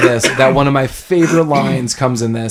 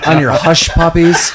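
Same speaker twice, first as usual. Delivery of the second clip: quoted odd line; 4 semitones higher, 6 dB louder than usual. Retold or imitated. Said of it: "the way he says it".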